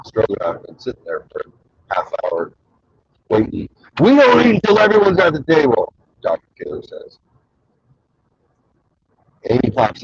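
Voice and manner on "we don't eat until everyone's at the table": loud, high-pitched quoted house rule; mock stern decree. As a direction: lay down the law in a raised, put-on voice. Quoting Doctor Taylor's dinner-table rule.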